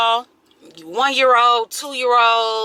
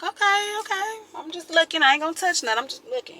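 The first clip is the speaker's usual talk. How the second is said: high, light "just looking" voice; playful ogling; 8 semitones higher, faster than usual. Acted. Eyeing swole dudes at the gym, playfully coy. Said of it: "I be like".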